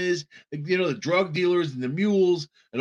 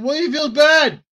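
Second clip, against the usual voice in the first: indignant outburst; 11 semitones higher, 6 dB louder than usual. Real, not acted. Ranting at fake sympathy.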